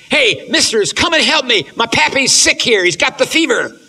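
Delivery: put-on high, loud pleading boy's call; loud, high plea from the raft to the boat; Huck calling for help. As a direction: holler for help like a frightened boy on a raft. Huck calls to the slave hunters.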